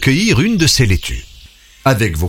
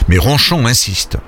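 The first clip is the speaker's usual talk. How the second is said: ordinary delivery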